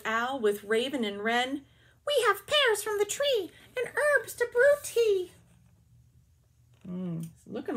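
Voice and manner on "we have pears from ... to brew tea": high, louder birdlike voice; bird voices. Acted. Reader voicing the birds offering pears, high chirpy voice. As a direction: chirp it like a little owl and wren. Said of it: "the animals have to have different voices".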